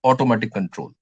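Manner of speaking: ordinary talk, nothing marked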